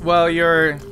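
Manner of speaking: usual speaking voice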